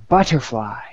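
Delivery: put-on savoured word in light English accent; a touch of admiring English accent; joke's English guy. English guy praising his own word, light English accent. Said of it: "the English guy says to the French guy".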